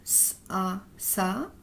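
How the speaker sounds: hissed S, then A, blended into "ça"; teacher sounding out "ça" letter by letter; syllable blending demo. Shown as a demonstration. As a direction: sound out S, A, then join them as "ça". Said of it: "ça se lit comme ça".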